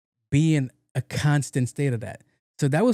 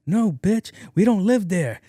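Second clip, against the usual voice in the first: mock shout back; 7 semitones higher than usual. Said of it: "he's like".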